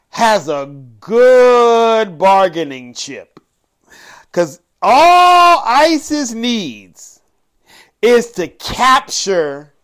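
slow, drawn-out words with heavy gaps before the key phrase; dramatic pauses. Real, not acted. Host warning listeners about the captured royal, dramatic pauses. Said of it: "why I took that pause".

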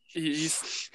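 usual speaking voice

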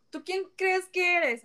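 high-pitched retold challenge in Spanish; calling someone out. Kitchen worker calls out a man on his BS, retold challenge. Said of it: "I've called men out on their".

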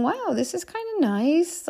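high, drawn-out wow of pleasure; pleasantly surprised. Retold or imitated. Mother relishing time alone at the airport, pleasantly surprised. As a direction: marvel slowly at a small luxury.

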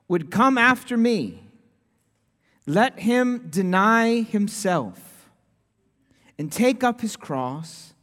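scripture reading. Acted. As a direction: let each clause land, unhurried. Reads the second scripture passage.

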